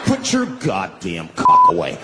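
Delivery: slow, low and loud order; loud scolding bystander. Acted. Bus passenger orders a man to cover up, loud scolding. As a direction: tell him off slowly in a big low voice.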